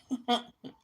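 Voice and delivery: a short, high, loud burst of mocking laughter; scornful laugh. Real, not acted. Mocking laugh after a rant.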